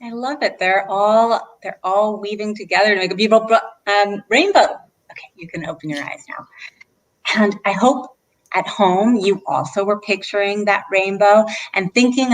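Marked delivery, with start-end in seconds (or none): 5.1-6.5 quick hushed whisper. Real, not acted. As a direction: whisper them gently back out of the picture. Ends the eyes-closed visualization.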